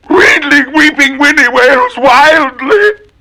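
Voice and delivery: high, slow wailing cry. Acted. Willie wailing wildly, high slow wail. Twister wailed in voice.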